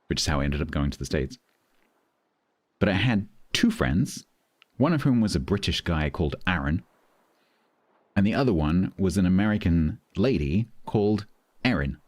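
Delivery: usual speaking voice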